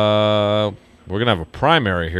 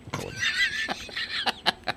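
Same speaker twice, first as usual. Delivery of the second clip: high laugh; 11 dB quieter than usual. Real, not acted. Laughs at being handed towel.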